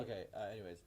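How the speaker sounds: faint, muffled mic; barely audible through bad mic; inside a submarine. Real, not acted. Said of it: "now we can hardly hear you".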